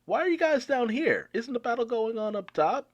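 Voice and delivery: high, bright friendly call; cheery Marco. Retold or imitated. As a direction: sing out like the hero arriving. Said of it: "in comes Marco the Phoenix saying".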